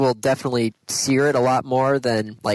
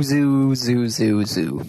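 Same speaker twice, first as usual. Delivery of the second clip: echo effect; 2 semitones lower, much slower than usual. Pretend. Repeating Kalamazoo like echo.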